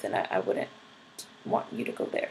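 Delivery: hushed whisper, barely voiced; whispered aside to viewers. Real, not acted. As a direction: drop to a secret whisper as a warning. Warning viewers off four chan.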